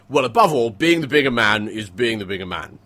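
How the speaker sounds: loud, lofty upper-class pronouncement; public school toff. Retold or imitated. Mocking the British public school mindset, loud lofty toff. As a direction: declaim it like a smug prefect. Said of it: "that is like".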